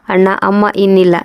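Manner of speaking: ordinary delivery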